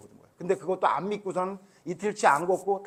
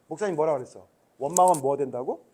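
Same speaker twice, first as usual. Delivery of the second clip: ordinary delivery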